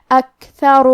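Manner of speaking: اکثر with a light, breathed kaf; breathy kaf demo. Shown as a demonstration. Teacher demonstrates the kaf, deliberate recitation. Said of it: "ایسے پڑھیں گے".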